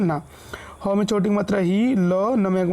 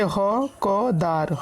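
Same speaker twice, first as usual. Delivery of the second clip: ह, क, दार spelled out with small gaps; syllable by syllable; much slower than usual. Shown as a demonstration. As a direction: break it into ह, क, दार for learners. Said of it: "इनको हम पढ़ते कैसे हैं". (अक्षर अलग-अलग करके)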